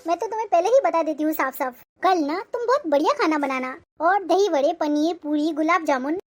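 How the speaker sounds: ordinary talk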